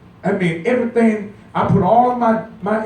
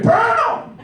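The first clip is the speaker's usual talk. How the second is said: joking shouted burn; 3 dB louder than usual. Retold or imitated. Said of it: "I'm saying".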